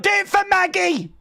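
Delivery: high pitched cheering yell; excited shout. Real, not acted. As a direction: scream encouragement at the screen. Cheering wrestler on.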